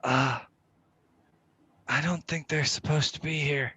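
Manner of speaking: hushed aside. Acted. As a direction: keep it down, almost whispering. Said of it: "whisper to my good pal Declan".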